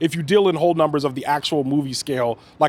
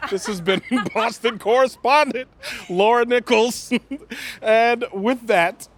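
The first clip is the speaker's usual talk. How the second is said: put-on Boston accent, pitched up; mock correspondent sign-off; announcer voice; 7 semitones higher, slower than usual. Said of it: "show host outro".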